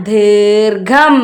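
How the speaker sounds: ദീർഘം very slowly in two parts; slow model reading of ദീർഘം; reading demonstration. Shown as a demonstration. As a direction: stretch both syllables of ദീർഘം. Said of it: "എന്താ വായിക്ക?".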